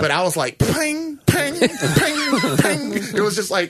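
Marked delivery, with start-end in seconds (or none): 0.6-2.9 high mock ping noises; pinging sound. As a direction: imitate the bar going ping. Mimicking bar bending sound.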